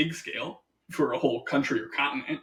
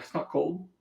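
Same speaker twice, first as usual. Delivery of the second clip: mock shrug; 2 semitones higher, 4 dB quieter than usual. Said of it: "going like".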